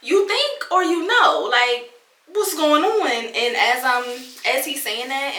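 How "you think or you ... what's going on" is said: sharp, higher, louder, pressing him; wife pressing hurt husband on phone, urgent; urgent demand. Retold or imitated. Pressing demand. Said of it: "And I go".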